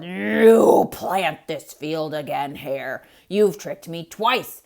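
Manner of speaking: low, loud, slow growl; growling bear. Acted. Bear demanding his due from Hare, low growl. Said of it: "Bear growled".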